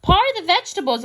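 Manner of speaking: high, quick and loud, lightly amused; amused exclamation. A narrator's reading. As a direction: read it as if tickled by the idea. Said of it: "Amelia Bedelia laughed".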